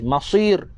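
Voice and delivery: "Masir" with a very heavy s; thicker sad. Shown as a demonstration. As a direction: deepen the s colour in "Masir". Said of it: "tebal lagi".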